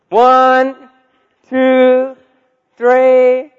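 loud, high game count, rising to a yell; calling out. Retold or imitated. Children starting hide and seek, calling out. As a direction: count out loud for the whole house.

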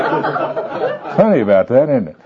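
slow words through a real laugh; amused laughing. Real, not acted. Speaker to the group after his punchline, laughing. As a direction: chuckle your way through the remark.

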